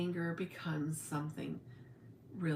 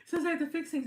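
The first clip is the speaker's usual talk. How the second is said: mock-upset, much higher and louder; upset outburst; 8 semitones higher, 7 dB louder than usual. Telling her husband why talking to her dad upsets her, higher and louder. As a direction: vent it at the husband, pitched way up. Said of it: "And I'm like".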